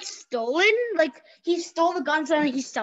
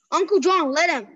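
usual speaking voice

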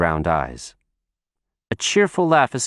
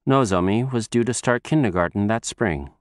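plain reading voice

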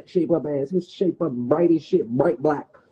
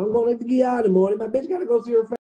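imitating Shaq, pitched higher; sing-song mock of his excuse; 8 semitones higher, 4 dB louder, faster than usual. Repeating his friend's excuse, higher mock voice. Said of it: "Tell me".

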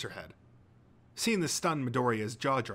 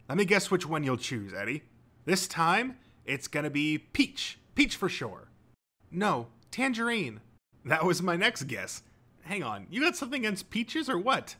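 higher, lively guesser's voice; Togata, playful declaration; 6 semitones higher, 3 dB louder than usual. A narrator's reading. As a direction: announce guesses boldly, then protest playfully.

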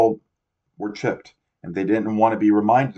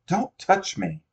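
high frightened yell; girl yelling; 11 semitones higher, slower than usual. A narrator's reading. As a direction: cry out high and sharp. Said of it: "Matt heard a girl yell".

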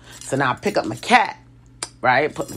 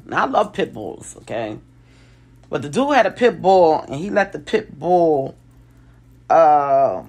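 normal talking voice

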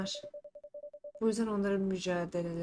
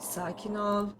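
ordinary talk, nothing marked

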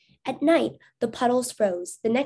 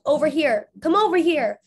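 higher, louder call across the water; 6 semitones higher, 7 dB louder than usual. Acted. Abe calling his dog across the stream, loud coaxing call. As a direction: coax the dog to come. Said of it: "Abe called".

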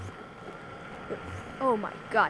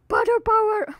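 Potter power pitched way up; a high, playful battle cry; high catchphrase; 10 semitones higher, 5 dB louder than usual. Shown as a demonstration. Catchphrase said again, higher.

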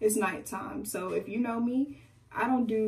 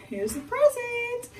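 nervous squeak; 14 semitones higher, slower than usual. Retold or imitated. Nervously handing parents the gift.

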